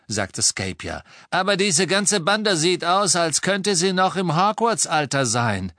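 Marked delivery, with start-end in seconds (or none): 1.3-5.7 high, loud henchman voice; Scabior, suspicious. Acted.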